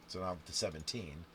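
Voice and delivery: ordinary talk, nothing marked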